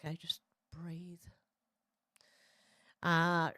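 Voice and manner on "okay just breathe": a soft calming whisper. Real, not acted. Whispering to Kai to calm down and breathe. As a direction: breathe the words out, no voice.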